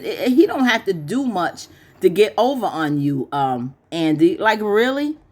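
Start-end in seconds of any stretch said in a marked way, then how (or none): none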